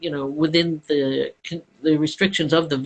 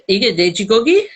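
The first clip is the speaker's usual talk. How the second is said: mock restaurant order; 5 semitones higher, 3 dB louder, much faster than usual. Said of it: "Tell us".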